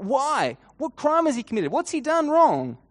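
high, loud protesting questions; yelling out. Acted. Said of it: "you hear Pilate yell out".

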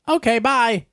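high-pitched, quick goodbye; telemarketer sign-off. Retold or imitated. Salesperson ending the warranty call, telemarketer sign-off. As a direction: imitate an agreeable caller signing off.

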